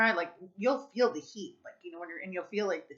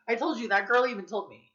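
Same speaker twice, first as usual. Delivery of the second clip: ordinary talk, nothing marked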